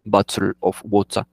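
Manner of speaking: "bottle of water" in a British accent, glottal T's swallowed. Shown as a demonstration. Teacher demonstrates British "bottle of water" to students. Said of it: "omitir las T's que son muy fuertes en su acento".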